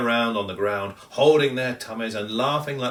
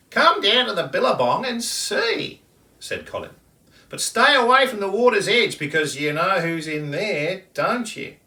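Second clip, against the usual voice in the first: high, loud bird voice with a warning; kookaburra warning; 10 semitones higher, 5 dB louder than usual. Acted. Kookaburra leading the kangaroo to the billabong. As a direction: lead him on brightly, then warn.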